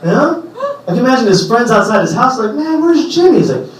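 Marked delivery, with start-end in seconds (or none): 2.5-3.4 put-on high, light kid voice; kid friend voice. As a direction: play a curious little kid. Kid friend asking, high pitched.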